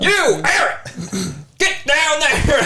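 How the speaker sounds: high barked command, slow; drill sergeant. Pretend. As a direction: order the recruit down like a drill sergeant. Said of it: "You do like a drill sergeant?".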